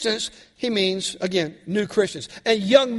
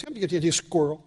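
Doug the dog; 3 semitones lower, 4 dB quieter, much faster than usual. Retold or imitated. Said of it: "He goes".

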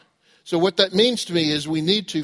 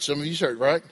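ordinary delivery

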